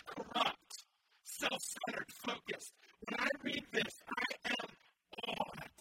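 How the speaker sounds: loud, impassioned sermon voice; rising pulpit fervor; fiery preaching. Acted. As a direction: preach it hard to the congregation. Preaching at full force.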